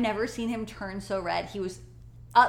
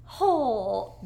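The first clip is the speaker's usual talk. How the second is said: put-on child's sing-song swear; 3 semitones higher, 5 dB louder, slower than usual. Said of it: "She's like".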